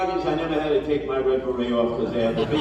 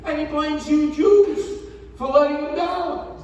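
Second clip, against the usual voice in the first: mock whine; 12 semitones higher than usual. Mocking Cuomo's complaint.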